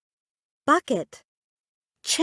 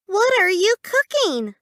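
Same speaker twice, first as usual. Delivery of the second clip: put-on child asking; 9 semitones higher than usual. Child asks mom in story.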